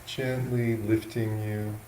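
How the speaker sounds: slow, unhurried guidance with long pauses between phrases; guided meditation. Real, not acted. Monk guiding yogis into an upright sitting posture, slow meditation voice. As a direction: talk them gently into posture, a little softly, leaving space.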